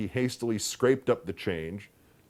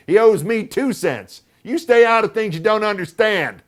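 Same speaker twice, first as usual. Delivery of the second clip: tart aunt scolding; 12 semitones higher, 12 dB louder than usual. A narrator's reading. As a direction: put the child in his place. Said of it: "she said tartly".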